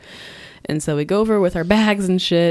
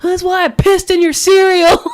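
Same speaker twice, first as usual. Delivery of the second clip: high, loud imitation of a yelling son; mock yell, pitched way up; retold screaming; 12 semitones higher, 7 dB louder than usual. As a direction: act out his screamed comeback. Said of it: "C and his mom screaming".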